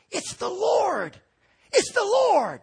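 John telling Peter he sees the Lord, in awe; awed exclamation. Retold or imitated. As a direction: cry it out in wonder. John recognizing Jesus.

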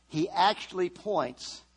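normal talking voice

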